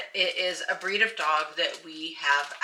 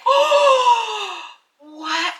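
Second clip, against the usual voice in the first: high shocked oh, louder; surprised gasp; 10 dB louder than usual. Retold or imitated. Hearing the puppies are for sale, high startled gasp. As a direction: gasp in disbelief. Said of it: "I'm like".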